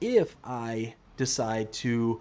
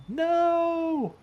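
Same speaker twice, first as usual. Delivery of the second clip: long, high-pitched wail of no; dismayed cry. Real, not acted. Streamer reacting to having missed, dismayed cry.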